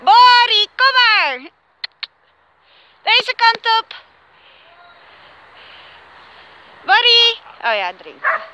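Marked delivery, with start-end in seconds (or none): 0.0-7.4 high loud call across the field; calling the dogs. Real, not acted. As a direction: call the dogs over from far off. Roept honden terug. (hoog en luid roepen naar de honden)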